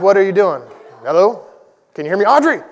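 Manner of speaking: retelling how he tried to get his wife's attention, rising to a call. Retold or imitated. Husband retelling his unanswered texts to his wife, a mock call building to a shout.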